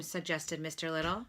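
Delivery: ordinary delivery, nothing marked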